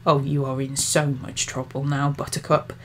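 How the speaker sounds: thin spiteful threat. A narrator's reading. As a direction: spit the threat at her quietly. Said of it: "His voice was thin and full of spite".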